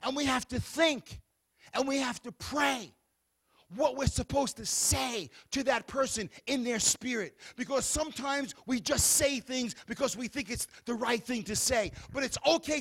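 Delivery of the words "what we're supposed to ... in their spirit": ordinary talk, nothing marked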